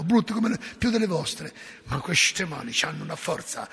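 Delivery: usual speaking voice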